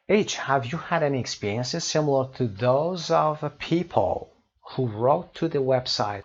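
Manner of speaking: slow, deliberate English read-out; measured classroom reading; teacher reading aloud. Acted. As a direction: read it out slowly for learners. Reads textbook question aloud.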